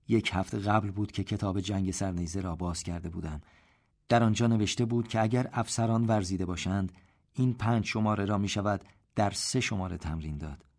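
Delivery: usual speaking voice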